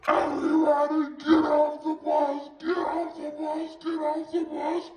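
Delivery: mock order, repeated like a chant; yelling sergeant. Retold sergeant ordering everyone off bus.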